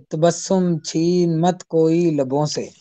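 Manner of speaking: measured ghazal recital, each line lingering; poetry recitation. Acted. (غزل کا ترنم سے پڑھنا)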